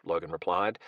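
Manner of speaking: ordinary delivery, nothing marked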